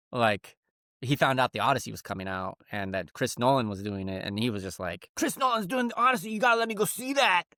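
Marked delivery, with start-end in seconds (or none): 5.2-7.4 imitating an eager kid, pitched way up; high, urgent retold plea; excited son. Son begs dad to see The Odyssey, high excited voice.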